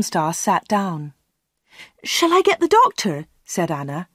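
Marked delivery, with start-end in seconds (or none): 2.0-3.3 high-pitched anxious question; concerned. A narrator's reading. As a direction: ask in worry, pitch raised. Anna worries for crying customer.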